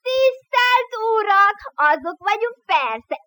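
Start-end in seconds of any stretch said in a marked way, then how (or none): 0.1-1.6 high, slow, booming mock address; the crowd thunders it back in mockery; mocking roar. Prisoners mock the boy's greeting, booming slow roar. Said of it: "Harsogott a haóta".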